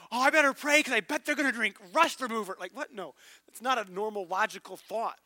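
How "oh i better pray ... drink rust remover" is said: higher and louder, hammed-up; a high, loud sarcastic aside; mock absurd premonition. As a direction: parody a ridiculous worry.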